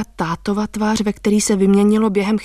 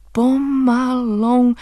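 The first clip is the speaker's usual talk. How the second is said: drawn out; 4 semitones higher, much slower than usual. Acted. Fragmented memories slow down.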